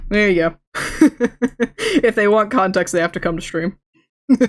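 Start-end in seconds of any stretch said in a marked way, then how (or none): 0.7-1.9 high giggle; laughing. Real, not acted. Laughing at own joke.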